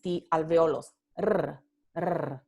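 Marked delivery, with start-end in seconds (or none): 1.2-2.4 R, r rolled with several tongue taps; trilled r. Shown as a demonstration. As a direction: roll the r hard. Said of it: "hay múltiples golpes".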